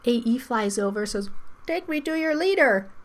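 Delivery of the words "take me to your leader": high-pitched alien robot voice; weird megaphone voice. Acted. Fairy talks through a megaphone to fool the vowels, weird high voice. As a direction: put on a strange disguised voice, pitched well up. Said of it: "her voice was all weird".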